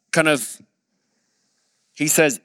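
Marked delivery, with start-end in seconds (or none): none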